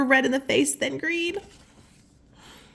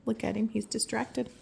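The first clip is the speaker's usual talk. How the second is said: soft aside; 8 dB quieter than usual. Real, not acted. Reader's soft aside on picture.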